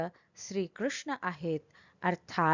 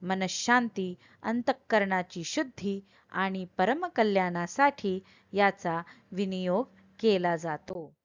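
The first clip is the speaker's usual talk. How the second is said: ordinary talk, nothing marked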